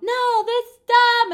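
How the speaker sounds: put-on high, loud, slow childish whine; drawn-out sulky whine; whiny kid voice. Friend voiced whining in story.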